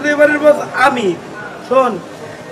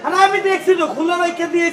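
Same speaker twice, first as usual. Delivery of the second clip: flustered protest; 6 semitones higher than usual. Acted. Guard defends himself to boss.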